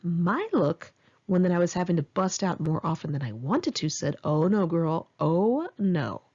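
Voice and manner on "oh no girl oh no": plain reading voice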